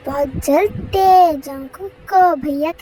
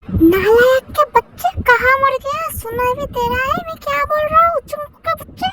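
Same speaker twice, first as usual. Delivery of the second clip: higher and louder, a scolding parent's yell; angry scolding; 9 semitones higher, 6 dB louder than usual. Acted. Parent yelling at the son who isn't listening, angry scolding. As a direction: tear into the lazy son.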